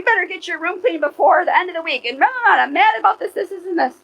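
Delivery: loud, high, rattled-off mock scolding; mock mad nagging. Mother telling the kids to clean up, exaggerated mad nagging. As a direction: imitate a mother on a tirade, pitched high. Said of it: "be mad about the dishes".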